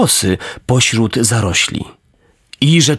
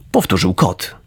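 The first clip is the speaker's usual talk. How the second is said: ordinary delivery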